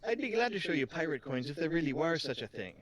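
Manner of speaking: put-on lightly Irish-accented shopkeeper; a hint of an Irish lilt; accidental Irish accent. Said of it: "Why is she Irish?".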